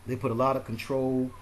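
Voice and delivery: ordinary talk, nothing marked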